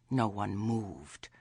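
usual speaking voice

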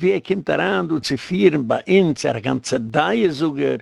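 ordinary talk, nothing marked

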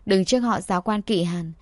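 plain reading voice

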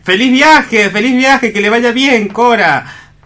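high, bright call of goodbye; cheery high farewell. Acted. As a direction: sing out the farewell. Hans calls out farewell.

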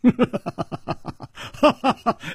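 high rolling chuckle; amused high laugh. A narrator's reading. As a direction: chuckle at length before replying. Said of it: "楚留香笑着说".

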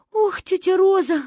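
high-pitched woeful exclamation; acted tearful wail. A narrator's reading. Kristina cries to Aunt Roza, high tearful lament. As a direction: lament as if starting to cry. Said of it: "заплакала Кристина".

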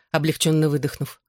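ordinary delivery

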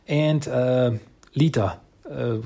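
usual speaking voice

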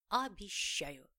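slow, loud and solemn; solemn promise. A narrator's reading. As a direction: pledge it like a vow. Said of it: "торжественно согласилась Елена".

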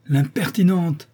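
a marquis crying out in offended disbelief; indignant exclamation. A narrator's reading. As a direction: fling the word out, scandalised. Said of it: "s'écria-t-il".

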